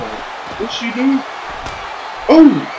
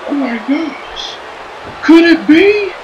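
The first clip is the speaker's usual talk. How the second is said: excited; 11 semitones higher, much slower than usual. Acted. Wrestler entrance hype call.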